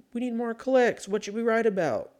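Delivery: high, eager fake newsroom voice; lightly voiced clueless editor; mock editor voice. Mocking article writers.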